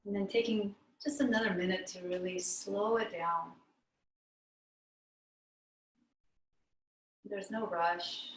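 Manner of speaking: calm meditation teacher with long pauses; guided meditation. Real, not acted. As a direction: guide them inward, unhurried, leaving long silences between phrases.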